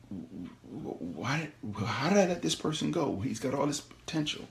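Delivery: quietly regretting letting him go; soft regret. Pretend.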